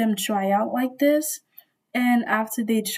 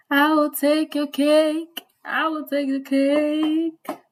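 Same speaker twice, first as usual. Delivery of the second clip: slow, drawn-out, higher-pitched insistence; hammed-up cake demand; 5 semitones higher, slower than usual. Storyteller playfully demanding the older lady's cake, hammed-up and drawn out. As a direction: stretch each word out with mock greed.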